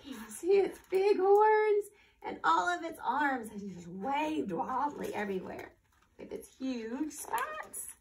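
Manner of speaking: lively, expressive storybook reading; reading to children. Acted. Teacher reading a story to children, lively storybook voice. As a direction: read it out like a picture book for a class. Teacher reads picture book aloud.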